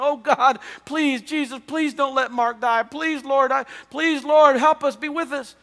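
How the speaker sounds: loud, high, desperate prayer to God; fervent pleading prayer. Retold or imitated. Friend prays aloud in the car for injured Mark, loud and pleading. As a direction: cry out to Jesus, begging for a friend's life. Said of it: "he was very fervently praying".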